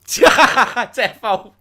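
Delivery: laughs out a loud, high "Defo"; laughing yes. Real, not acted. Cohost jokes about a GPS tracker, laughing loud agreement.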